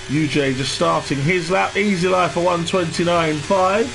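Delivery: game commentator. Acted. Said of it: "Why do I sound like a game commentator?".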